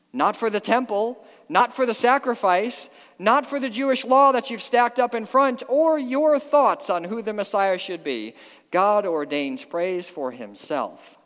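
loud, high-pitched sermon delivery; impassioned preaching. Real, not acted. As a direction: build to an incredulous high peak. Preacher at full force.

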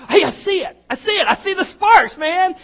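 put-on high, loud excited kids' shout; excited kids. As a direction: cry out thrilled like kids in the dark. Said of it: "some kids were like".

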